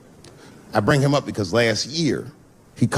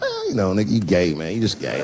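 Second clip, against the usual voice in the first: mock mumbling; 6 semitones lower than usual. Half-listening to friend on the phone, mock mumbling. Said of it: "mumbling shit throughout this conversation".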